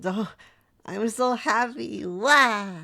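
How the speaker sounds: high, loud gushing joy breaking into a laugh; overjoyed. Acted. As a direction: gush with happiness, then laugh. Pet overjoyed at being understood.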